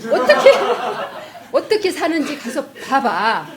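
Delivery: a sudden high squeal of laughter breaking the quote; bursting into laughter. Real, not acted. Laughing as she retells her husband's retort to her. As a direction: laugh right through the retold retort.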